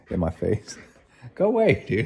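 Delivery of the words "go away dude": sudden high-pitched shoo; real shooing off. Real, not acted. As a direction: break off and shoo it away, pitched way up. Shooing something away mid-talk.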